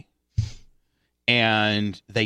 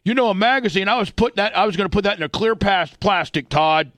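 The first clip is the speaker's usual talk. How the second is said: put-on higher, louder old-timer lecturing; old timer lecture; 8 semitones higher, 4 dB louder than usual. Father lecturing his son like an old timer, raised voice. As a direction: lecture like a grumpy old man. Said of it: "starts getting into the old timer shit".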